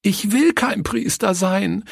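put-on high, sobbing-style child's protest; tearful child crying out. A narrator's reading. Leni refusing to play the priest, tearfully crying out. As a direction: cry out as an upset little girl. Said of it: "rief sie schluchzend".

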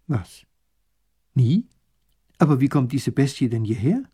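a touch of confused surprise; puzzled, mild disbelief. Acted. Grand duke hearing tigers never lived there, confused. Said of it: "fragt verwirrt".

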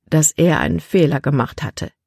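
ordinary delivery

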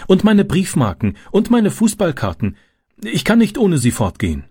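high, pleading objection; insistent child's protest. A narrator's reading. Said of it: "protestierte Francis".

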